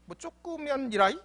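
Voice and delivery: ordinary talk, nothing marked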